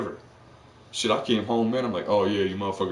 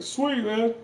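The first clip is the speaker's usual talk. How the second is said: high, loud cartoonish goodbye; doomed guy waving off his friends, high and chirpy; mock cheerful farewell; 5 dB louder than usual. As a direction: sing out a breezy last goodbye.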